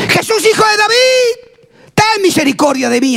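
high, drawn-out cry for mercy; high-pitched acted shout of a beggar. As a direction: call out pleadingly, stretching the words. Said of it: "empezó a gritar a gran voz".

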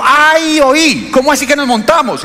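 high, amused, half-laughing Antioquia man; mock the man's amused disbelief, pitched high. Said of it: "empezó a reírse y dijo".

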